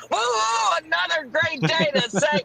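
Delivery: high, slow, excited whoop; excited cheer. Acted. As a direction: cheer it out gleefully.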